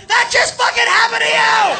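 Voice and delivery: high, loud shout; gleeful taunting yell. Retold or imitated. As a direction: yell like a friend rubbing it in. Friend yelling at buddy in story.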